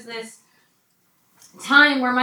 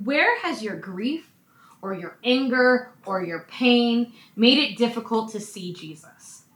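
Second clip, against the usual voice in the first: ordinary delivery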